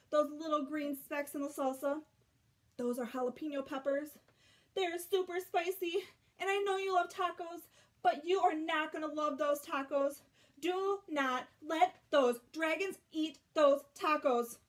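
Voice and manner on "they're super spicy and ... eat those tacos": louder, higher, building alarm; urgent warning. A narrator's reading. Reader warning the dragons off the spicy tacos, urgent and loud. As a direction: plead urgently, slowing at the end.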